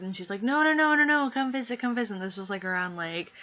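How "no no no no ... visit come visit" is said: high, loud insistent no no no, fading; excitedly begging her to come over; eager pleading. Retold or imitated. Friend urging her to visit.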